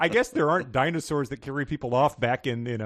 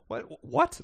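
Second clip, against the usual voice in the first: high squeaky What? What?; a shocked What? shooting up; mock disbelief; 12 semitones higher than usual. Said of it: "It's like".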